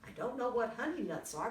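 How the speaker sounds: plain reading voice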